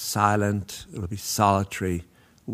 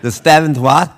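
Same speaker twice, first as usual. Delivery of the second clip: much higher and louder, incredulous; baffled question; 8 semitones higher, 12 dB louder than usual. Retold or imitated. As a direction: ask it as if the phrase makes no sense. Baffled by "seventh inning stretch.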